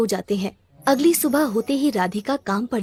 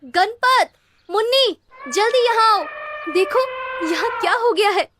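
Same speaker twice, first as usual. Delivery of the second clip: much higher, louder urgent call; calling loudly; 12 semitones higher, 3 dB louder than usual. Acted. Mother loudly calls Ganpat and Munni to come see. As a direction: shout for the kids to come quick. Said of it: "जोर से आवाज लगाती है".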